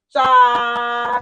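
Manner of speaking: loud disgusted cha; mock disgusted shout. Teacher mocking chips fried in groundnut oil, with a disgusted shout. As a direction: spit it out in disgust.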